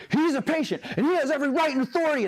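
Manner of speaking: high, mock-formal lecturing voice; stiff rulebook medic. Mocking a know-it-all medic to the cop, high and stiff. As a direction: parody of a preachy medic, pitched well up. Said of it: "I'm not like".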